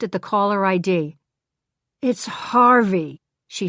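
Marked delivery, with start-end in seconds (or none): 2.0-3.2 slow, weary sigh of a name; fed up at the phone ringing; exasperated. Acted. Glenda, exasperated by Harvey's call, slow and weary.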